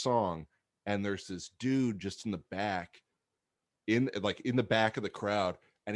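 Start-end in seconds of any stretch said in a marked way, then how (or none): none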